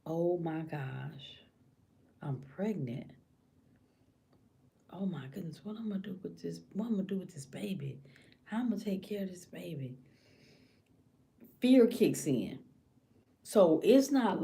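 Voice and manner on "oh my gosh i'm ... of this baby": hushed, softly acted worry; scared inner thought. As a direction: think it aloud under your breath. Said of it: "That woman is thinking".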